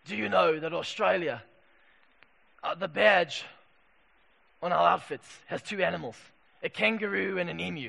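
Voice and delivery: low, gruff cricket captain voice, lightly done; a hint of a gruff old Australian captain; imitating the gravel of an old sports captain; gruff Kim Hughes. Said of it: "in his voice".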